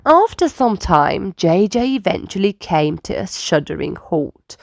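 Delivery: usual speaking voice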